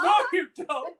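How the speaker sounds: put-on high, loud laughing old man. Echoes in old voice.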